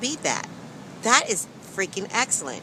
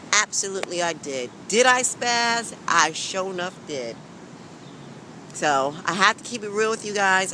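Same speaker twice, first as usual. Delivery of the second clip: ordinary talk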